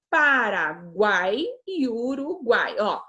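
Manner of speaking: slow, syllable by syllable; teacher spelling out country names for pupils. Shown as a demonstration. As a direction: split each word into its syllables. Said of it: "Guai é uma sílaba só".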